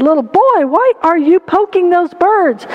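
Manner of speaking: a touch of pained protest; distressed pastor. Acted. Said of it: "the pastor can't stand it".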